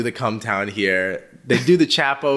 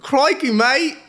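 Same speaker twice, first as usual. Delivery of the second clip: high, hammed-up British accent; exaggerated British accent exclamation; put-on British accent, much higher; 3 dB louder, slower than usual. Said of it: "That's the extent of my British accent".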